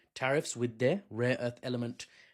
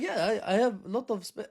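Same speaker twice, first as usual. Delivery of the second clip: mock Brazilian accent; 8 semitones higher than usual. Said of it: "I don't know what accent to do for Brazil".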